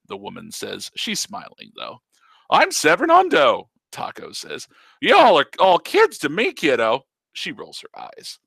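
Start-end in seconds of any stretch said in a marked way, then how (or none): 2.5-3.6 much higher, louder cartoonish old man; old uncle teasing his niece in a silly voice; teasing old Taco. 5.0-7.1 much higher, louder cartoonish old man; old uncle teasing his niece in a silly voice; teasing old Taco.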